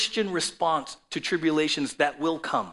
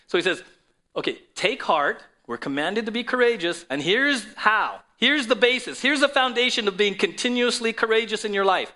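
preaching at full force; 7 semitones higher, 7 dB louder than usual. Acted. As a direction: build the sermon to a booming peak.

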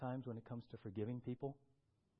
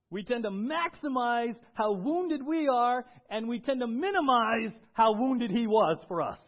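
full-force preaching; 10 semitones higher, 14 dB louder than usual. Acted. Pastor warning the church about maximizing hurt, loud preaching. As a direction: drive each line home to the church.

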